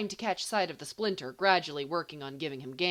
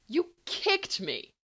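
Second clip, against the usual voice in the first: high, slow, shocked outburst; outraged yelp at a friend; indignant cry; 10 semitones higher, 4 dB louder, slower than usual. Acted. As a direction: yelp it out, stung and accusing. Sudden accusation after being kicked.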